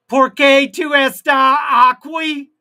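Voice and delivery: high, loud, slow mock Spanish yell; hammed-up Spanish shout. Streamer shouting at chat in a hammed-up mock Spanish accent. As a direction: bellow it like a TV telenovela.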